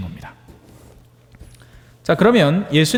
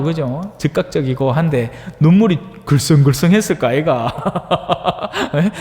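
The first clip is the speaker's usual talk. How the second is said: normal talking voice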